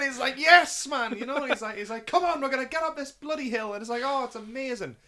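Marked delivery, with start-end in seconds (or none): 0.4-1.2 high, loud cheer; big triumphant shout of approval; elated cheer. Retold or imitated. 2.1-3.7 high, loud urging; rally an exhausted friend uphill; acted-out heroic push, pitched right up; rallying cry. Acting out Sam's rallying cry.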